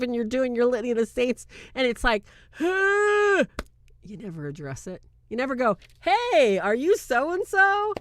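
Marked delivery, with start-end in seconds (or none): 2.5-3.4 loud, high-pitched mock gasp; drawn-out high noise of mock shock; high startled "huh". Imitating a startling demonic interruption. 6.0-7.9 loud, high, drawn-out sarcastic greeting; hammed-up high hailing question; mock chummy hail. Mock friendly greeting to a demon.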